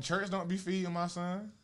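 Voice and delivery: ordinary delivery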